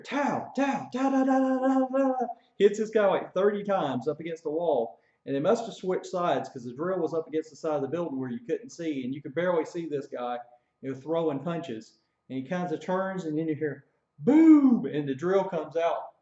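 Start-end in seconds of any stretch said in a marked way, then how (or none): 0.0-2.3 rapid high punch noises; punch sound effects. Retold or imitated. Recruit punching the drill against the wall, mouth sound effects. 14.2-14.9 very loud high boom; knockout boom. Retold or imitated. Recruit knocking the drill out, big loud boom. Imitating final knockout punch.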